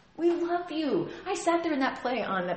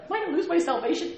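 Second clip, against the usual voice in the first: mock worried; 7 semitones higher, 3 dB louder, faster than usual. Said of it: "She was like".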